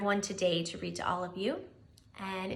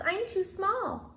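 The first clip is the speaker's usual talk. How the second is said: high, slow little mouse voice; squeaky-high small mouse, slowly; tiny mouse; 10 semitones higher, slower than usual. Acted. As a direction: sound small and a bit sorry. Mouse speaking.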